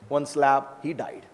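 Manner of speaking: ordinary delivery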